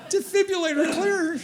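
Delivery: high, breathless mock panic; excited nurse. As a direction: squeal it like a frantic young nurse. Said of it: "grabbing my arm and saying".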